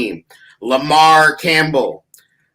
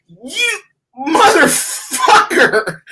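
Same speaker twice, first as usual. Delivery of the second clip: furious shout; 11 semitones higher, much slower than usual. Retold or imitated. Host reliving his reaction to Ted on the call, slow outraged yell. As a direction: roar it out like you just got betrayed. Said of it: "I was so mad".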